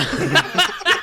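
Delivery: high rising laugh; burst of laughter. Real, not acted. Laughing at the Dave's mum joke. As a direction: crack up at the joke.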